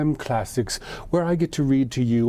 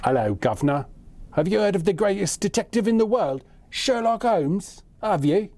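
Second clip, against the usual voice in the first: high-pitched, hammed-up London accent; cheesy London greeting accent, raised pitch; bad London accent; 7 semitones higher than usual. Shown as a demonstration. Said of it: "doing this in my London accent".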